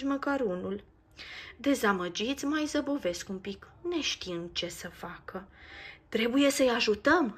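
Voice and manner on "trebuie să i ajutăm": a firm, louder resolve; determined. Acted. Nico decides to help the children, firm and determined. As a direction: set your mind on it. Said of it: "pe un ton hotărât".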